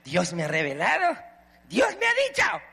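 mock holy woman claiming revelation; mocking gossip sister. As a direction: mimic a fake prophetess. Preacher mocks gossiping sisters.

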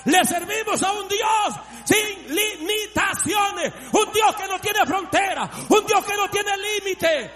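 high, loud, fervent testimony; shouting revival preacher; full-force preaching. Acted. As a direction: preach it out with fire to the congregation.

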